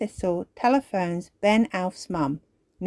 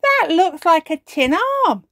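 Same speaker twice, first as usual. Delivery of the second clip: excited cry; 10 semitones higher, 7 dB louder than usual. Acted. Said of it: "cries Barnaby".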